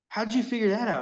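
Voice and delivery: high-pitched incredulous question; imitating his own astonished question; retold amazement. Retold amazement at friend's trick.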